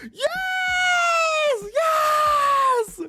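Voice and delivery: very high, loud yes shriek; high loud joyous shriek; fangirl scream. Real, not acted. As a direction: scream for the favourite character. Favourite character named.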